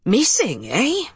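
thick Irish brogue; Irish accent. Acted. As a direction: speak like an Irish policeman. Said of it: "Officer Colum Farrell's accent was thick Irish".

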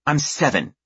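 put-on snapping child. A narrator's reading. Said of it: "she snaps".

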